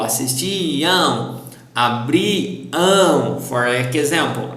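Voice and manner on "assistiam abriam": slow "Assistiam", "Abriam" with drawn-out "iam"; stressed last syllable. Shown as a demonstration. Teacher models "Assistiam" and "Abriam" after the reminder, stressed endings. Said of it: "Remember to stress the last syllable".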